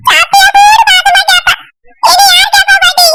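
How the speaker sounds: put-on high-pitched young boy voice; high boyish voice, upset over big sister; little brother. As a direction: plead to grandma as a small boy, pitched well up.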